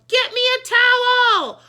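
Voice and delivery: high loud slow demand; yell. A narrator's reading. Mister Klutz demanding a towel, a loud slow yell. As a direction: bellow for the towel. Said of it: "he yelled".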